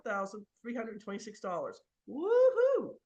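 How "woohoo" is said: a big, much higher, much louder cheer; celebrating the auction windfall; gleeful whoop. Real, not acted. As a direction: whoop like you just won. Auction price jumps to 64k.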